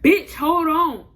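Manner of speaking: loud, high, drawn-out retort; irritated retort. Retold or imitated. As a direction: act out his annoyed comeback, stretching the words. Acts out his snapped reply.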